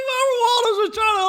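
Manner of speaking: high squealing laughter while talking; laughing hard. Real, not acted. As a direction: crack up mid-sentence. Laughing hard mid-story.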